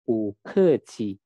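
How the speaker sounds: "bu ke qi" slowly, each syllable separate; Mandarin model. Shown as a demonstration. Teacher shows learners how to read it, slow Mandarin model. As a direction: stretch "bu ke qi" and puff the middle syllable. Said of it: "ini cara membacanya".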